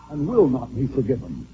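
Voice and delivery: low, hushed after the shout. Real, not acted. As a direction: drop to a hush. Sudden drop after shout.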